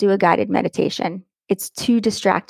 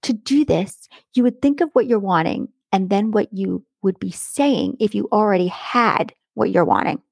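ordinary delivery

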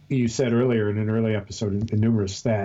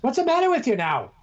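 much higher mock British scold; scolding wife; 8 semitones higher than usual. Imagined wife scolding him for talking British, high scold. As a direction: tell him off like an exasperated wife. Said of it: "My wife would say".

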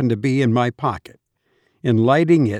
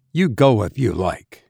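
ordinary delivery, nothing marked

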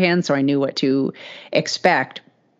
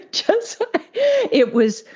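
laughing; slower than usual. Real, not acted. Laughing at her own story.

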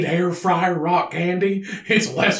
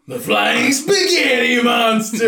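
excited shout; 3 semitones higher, slower than usual. Real, not acted. Excited naming of the deity.

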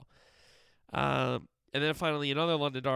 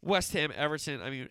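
ordinary talk

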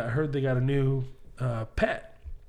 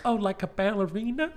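put-on higher, wide-eyed child's question; innocent little kid; 6 semitones higher than usual. Little boy asking friend's mom about her job, innocent kid voice. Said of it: "I was a little kid".

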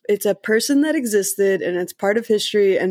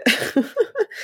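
high laugh; 12 semitones higher than usual. Real, not acted. Laughing at joke.

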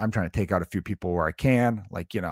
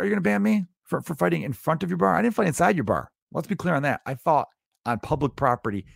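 indignant; 7 semitones higher than usual. Retold or imitated. Bar argument retold.

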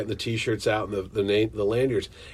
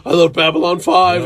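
loud, eager fan declaration; nerding out; 4 semitones higher, 10 dB louder than usual. Retold or imitated. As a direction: blurt it like a superfan. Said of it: "totally nerding out".

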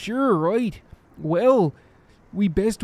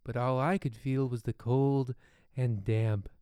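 ominous narration; 8 semitones lower, 7 dB quieter than usual. Acted. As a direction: speak it low like a dark secret. Ominous intro over music.

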